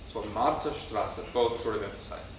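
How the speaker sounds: ordinary talk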